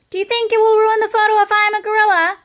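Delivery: high, loud, eager question; rally the class with a bright high ask; eager child. A narrator's reading. Girl polling her classmates, high and eager.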